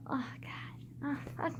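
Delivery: pained whisper under her breath; whispered curse. Real, not acted. Cursing to herself because her arm hurts, whispered. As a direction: breathe the curse out, barely voiced.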